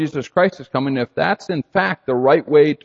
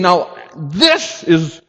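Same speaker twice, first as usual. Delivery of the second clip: louder and higher, full conviction; emphatic declaration; 9 semitones higher, 6 dB louder than usual. Retold or imitated. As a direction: pound home the authority. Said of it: "He is saying".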